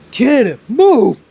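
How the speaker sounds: high, loud warning shout; urgent scream. Acted. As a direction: scream it out to get someone clear. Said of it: "All Might screams out".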